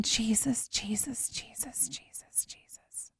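whispered prayer fading to nothing; desperate whispered prayer. Retold or imitated.

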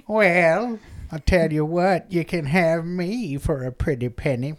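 soft, coaxing crone voice; old madam. Acted. Old madam offering herself to a customer, coaxing. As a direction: offer yourself like a wily old woman. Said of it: "she's all old".

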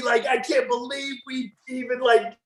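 high-pitched jeering fan impression; mocking heckler. Retold heckler destroying him from the stands.

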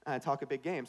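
ordinary delivery

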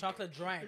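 "drink" said with an A, as "drank"; "drink" with its I turned into an A. Pretend. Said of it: "It doesn't even have an I. It has an A".

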